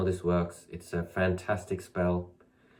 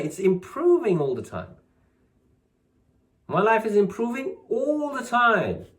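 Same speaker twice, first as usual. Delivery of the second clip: higher and louder, building conviction; fervent affirmation; 10 semitones higher, 4 dB louder than usual. Shown as a demonstration. Chanting the spell to students with rising conviction. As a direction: declare it like you fully believe. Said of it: "building affirmation".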